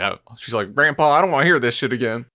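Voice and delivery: ordinary talk, nothing marked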